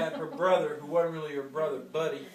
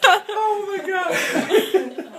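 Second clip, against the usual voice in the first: laughing; 11 dB louder, much slower than usual. Real, not acted. Laughing at the memory.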